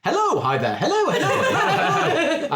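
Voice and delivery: leaping high-pitched overlapping hellos; automaton chorus. Acted. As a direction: greet like a crowd of cheery robots. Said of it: "And then you hear a chorus of".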